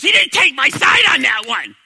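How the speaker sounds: hurled back at him like an accusation; angry wife. Retold or imitated. Wife yelling at her husband that he didn't back her, angry shout. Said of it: "She goes".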